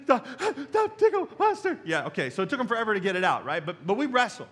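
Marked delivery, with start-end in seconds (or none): 0.0-0.3 high, squeaky plea through laughter; breathless laughing kids. Retold or imitated. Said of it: "'cause they're like". 0.7-1.8 high, squeaky plea through laughter; breathless laughing kid. Retold or imitated. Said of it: "'cause they're like".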